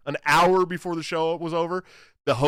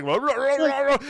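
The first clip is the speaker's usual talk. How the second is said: mock ranting gibberish, higher and louder; muted ranting noise; 10 semitones higher, 4 dB louder than usual. A muted host mimicked ranting at everyone, rah rah. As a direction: mimic a muted man yelling nonsense. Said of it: "and he's like".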